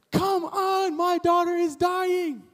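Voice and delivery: high, slow pleading cry; urgent father begging Jesus to hurry; desperate plea. Retold or imitated. Jairus pleading about dying daughter.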